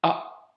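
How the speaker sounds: A whispered with a catch before it; A started with a glottal stop. Shown as a demonstration. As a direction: block the throat, then breathe out A. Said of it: "Tu mets juste un petit blocage avant".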